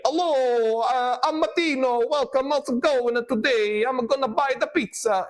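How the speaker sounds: high, exaggerated movie Italian accent. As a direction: ham up the cartoon Italian accent from films. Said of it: "you think that we speak like".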